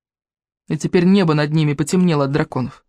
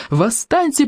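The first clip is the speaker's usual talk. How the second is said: spat out high; 9 semitones higher, slower than usual. A narrator's reading. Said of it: "плевались они".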